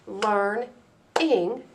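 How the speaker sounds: "learn-ing" said as two separate beats; syllables split apart. Shown as a demonstration. Teacher clapping the word learning into syllables, slowly. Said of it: "taking the word learning".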